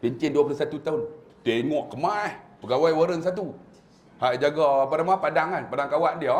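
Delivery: usual speaking voice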